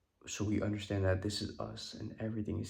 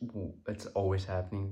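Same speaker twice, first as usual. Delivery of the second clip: ordinary talk, nothing marked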